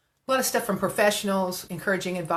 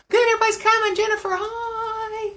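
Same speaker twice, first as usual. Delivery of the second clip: high-pitched singsong hello; excited, very high welcome; delighted greeting; 14 semitones higher than usual. Real, not acted. Greeting arrivals on livestream.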